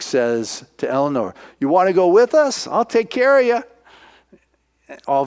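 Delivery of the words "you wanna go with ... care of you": put-on high, eager boy's offer; boyish invitation. Boy inviting Eleanor along, high and eager. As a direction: offer it like an earnest kid.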